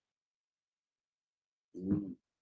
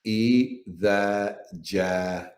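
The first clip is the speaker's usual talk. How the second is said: slow, one word at a time; staccato; much slower than usual. Shown as a demonstration. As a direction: space out each word, slowly. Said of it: "say just one word at a time".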